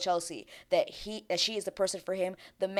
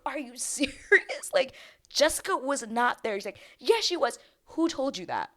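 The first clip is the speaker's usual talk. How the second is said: acted incredulous argument; 7 semitones higher, 4 dB louder than usual. Acting out man's incredulous argument.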